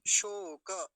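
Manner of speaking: शोक loud and long as shooo-ka; full final vowel. Shown as a demonstration. Teacher modelling the word, full final vowel. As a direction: stretch शोक and voice the ka. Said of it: "शोक भी नहीं कहेंगे".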